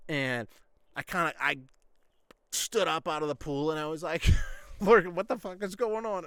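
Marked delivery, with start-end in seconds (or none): 4.8-6.2 raised, louder, half-laughing what-the-hell; baffled outburst. Retold or imitated. Swimmer to laughing coach, retold baffled outburst. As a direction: blurt it out confused and a bit loud.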